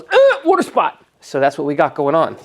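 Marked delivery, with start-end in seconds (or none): 0.0-1.0 much higher, slow put-on cartoon voice; whiny paint voice. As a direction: voice the car paint as a squeaky little victim. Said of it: "And then my paint goes".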